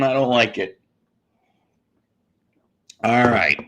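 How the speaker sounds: usual speaking voice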